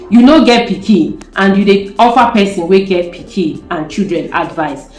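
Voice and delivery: says it in Nigerian Pidgin first, plain conversational Pidgin. Shown as a demonstration. To her viewers, saying it first in Nigerian Pidgin. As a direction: tell it the Lagos street way, in Nigerian Pidgin. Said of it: "let me speak it in a normal English".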